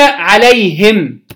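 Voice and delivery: عليهم said "alayhim", final m vowelless; silent final mim. Shown as a demonstration. Teacher showing students the first form, عليهم as "alayhim". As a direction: say عليهم slowly, closing on a bare m. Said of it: "بإسكان الميم".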